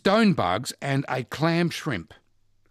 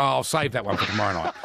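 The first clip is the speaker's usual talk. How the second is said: ordinary talk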